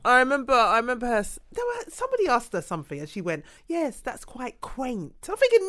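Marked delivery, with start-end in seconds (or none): 3.7-5.1 slow, soft mock posh English accent. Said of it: "when they're trying to speak posh".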